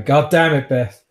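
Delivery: loud table-slamming exasperation; angry outburst. Acted. Father slamming the table at Beth, angry outburst. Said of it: "he slams the table going".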